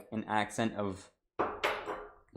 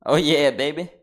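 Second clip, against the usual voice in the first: loud, high whoop of victory; triumphant cheer; 6 semitones higher, 14 dB louder than usual. Real, not acted. Celebrating the finished dish with a loud, high cheer. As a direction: crow over the win.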